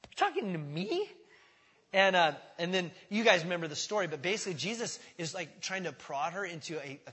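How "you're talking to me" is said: high, startled what; shocked anyone would speak to her; high disbelieving squeak; incredulous surprise. Retold or imitated. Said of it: "And she's like".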